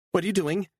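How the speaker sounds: high, alarmed cry; panicked woman. A narrator's reading. Woman seeing the pursuers in the mirror, high and panicked. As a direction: spot them and panic. Beatrice voiced by narrator.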